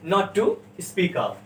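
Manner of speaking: slow, careful dictation pace; slow model phrase. Shown as a demonstration. Teacher dictating the phrase slowly to students. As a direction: spell the phrase out slowly for the class. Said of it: "क्या?".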